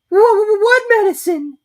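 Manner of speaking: very high, loud panicky stammer; alarmed stutter. A narrator's reading. Piglet horrified at the medicine.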